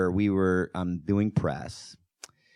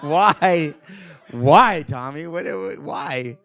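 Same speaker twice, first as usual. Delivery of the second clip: laughing disbelief; 8 semitones higher, 5 dB louder, much slower than usual. Retold or imitated. Laughing at Tommy's answer.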